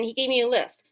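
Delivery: ordinary talk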